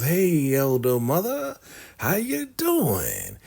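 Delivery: high, slowed-down fake-sweet hello; sugary greeting. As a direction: greet her with syrupy false politeness. Ruth's sweet greeting, retold.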